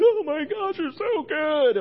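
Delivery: high, loud put-on wail; mock weeping. Pastor showing how they did not pray, mock weeping. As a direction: exaggerate an overcome, tearful cry. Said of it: "like, weeping".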